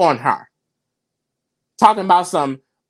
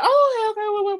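mimicked reaction; 13 semitones higher, much faster than usual. Host imitating everyone hearing the news, mimicked reaction. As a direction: imitate onlookers backing off, pitched way up.

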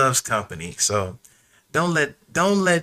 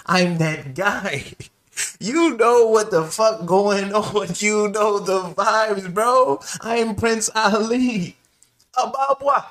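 higher, slower cocky brag; slow, high, show-off boast to a hater; boastful Aladdin; 8 semitones higher, slower than usual. Pretend. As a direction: strut through the words like a swaggering prince. Voicing Aladdin boasting to Jafar.

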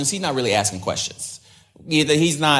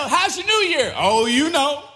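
fake cheerful; 14 semitones higher than usual. Mocking fake church answer.

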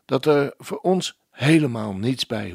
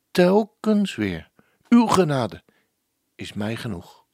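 hushed, devout reading with falling phrase endings, dropping to a whisper on o Heer; prayerful recitation; 3 dB louder than usual. Acted. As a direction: pray the lyric aloud. Presenter voices the hymn as a prayer. (biddend voorgedragen liedtekst)

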